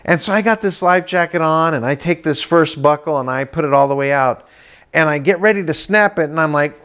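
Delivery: normal talking voice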